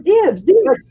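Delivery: high, loud mock claim; imitated eager daughter. Mom imitating her daughter claiming paintings, high eager call. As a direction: call it out like a kid claiming a prize.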